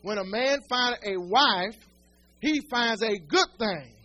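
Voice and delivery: slow, each word weighed for the point; slow emphatic repeat. Shown as a demonstration. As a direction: spell out the verse slowly so it lands. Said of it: "Did y'all hear that?".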